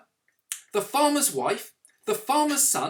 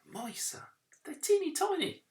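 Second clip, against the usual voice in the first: tiny mouse voice; 11 semitones higher, 6 dB quieter than usual. Acted.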